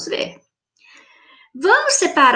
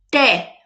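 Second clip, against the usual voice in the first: "Té" alone, firmly stressed; strong syllable. Shown as a demonstration. Teacher answering which syllable is strong, "Té" stressed. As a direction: give "Té" as one firm beat. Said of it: "Qual que foi a sílaba forte".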